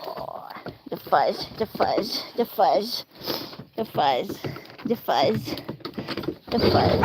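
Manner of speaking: low, repeated crooning to a dog; pet talk. Real, not acted. Cooing repeatedly while petting the dog.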